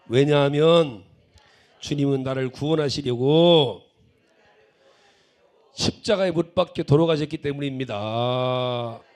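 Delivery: slow, booming confession of faith; loud declaration. Retold or imitated. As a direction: call it out at full volume, drawing the words out. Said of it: "큰 소리로".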